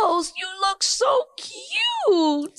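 high, slow, drawn-out coo; cooing at a dog. Real, not acted. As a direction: gush over a cute puppy.

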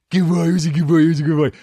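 excited pet-owner cooing, loud and high; praising a dog. Pretend. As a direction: gush over a good dog.

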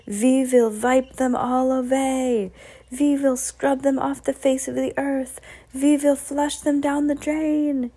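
high, ranting witch with v for w; Grand High Witch. A narrator's reading. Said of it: "screamed the Grand High Witch".

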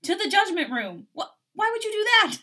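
high, loud, alarmed back-and-forth; acted heated exchange. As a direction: fire it off in dramatic dismay. Acted quarrel as she storms off.

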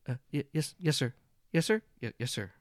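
stammering, jittery yes sirs, one jumping high; a scared junior stumbling over her words; nervous stammer. Acted. Nervous witch answering the boss, stammering in fright. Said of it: "nervously sitting at her desk".

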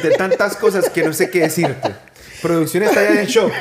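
talking through a real laugh; amused and lost for words; laughing, overwhelmed. Real, not acted. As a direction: laugh in happy disbelief as you speak. Overwhelmed host laughing with his guest.

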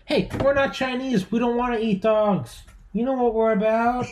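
high, goofy talking-dog voice; dog's voice. Pretend. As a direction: speak for the dog, high and silly. Voicing the dog.

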